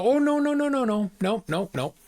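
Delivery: high, quick string of no's; imitating the woman's hurried no no no; eager protest. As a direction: mimic her rushing to deny it. Retold eager denial from woman.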